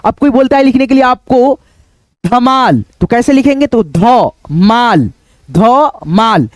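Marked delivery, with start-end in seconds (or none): 3.7-6.5 ध माल split into ध and माल, said slowly; sounded out. Shown as a demonstration. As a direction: break ध माल into its parts, slowly. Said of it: "यानी ध लिखेंगे पहले".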